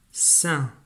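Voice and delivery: "sain" said alone with a clear falling end; dictated syllable. Shown as a demonstration. Teacher dictating to a pupil, clear and falling. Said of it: "je veux que tu écrives la syllabe".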